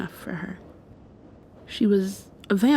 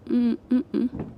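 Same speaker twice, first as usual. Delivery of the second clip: usual speaking voice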